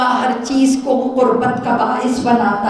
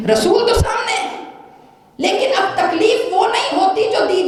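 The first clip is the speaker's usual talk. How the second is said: much higher, shouted preaching peak; emphatic shout; 9 semitones higher than usual. Real, not acted.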